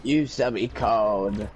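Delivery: low, slow Sith master voice; Palpatine role-play. Acted. Palpatine naming a new apprentice, low and solemn. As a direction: intone it slowly and low like Palpatine.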